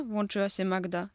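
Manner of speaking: ordinary delivery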